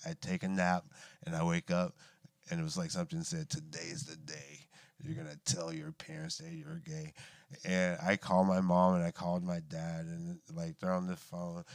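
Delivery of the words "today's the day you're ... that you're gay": very soft, imitated voice in the head; hushed inner voice. Inner voice on waking from a nap, hushed. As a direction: murmur it like a secret prompting.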